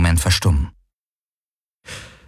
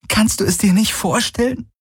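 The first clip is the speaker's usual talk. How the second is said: pleading. Acted. Kirai begging Galen to see her feelings, pleading. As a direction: beg him to understand. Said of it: "fast flehend".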